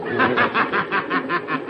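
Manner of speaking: a sudden laugh in a voice unlike his own; villain's laugh. Acted. Shark laughs at the hopeless situation in the dungeon. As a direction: laugh at the grim joke.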